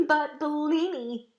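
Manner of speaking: pleading cry. Acted. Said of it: "Mirette said".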